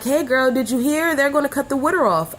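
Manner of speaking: high, fast friend with news; gossipy friend. Retold or imitated. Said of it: "she's like".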